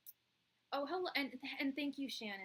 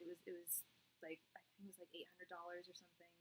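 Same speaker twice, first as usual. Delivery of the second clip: whispered aside; 3 semitones lower, 11 dB quieter, faster than usual. Real, not acted. Aside under breath.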